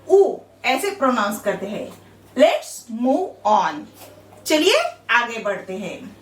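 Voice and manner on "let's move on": stretched out word by word; very slow phrase. Shown as a demonstration. Teacher slowly reading the phrase to the class. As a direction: draw it out slowly. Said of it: "teacher reading English line".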